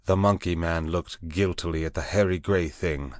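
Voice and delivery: ordinary delivery, nothing marked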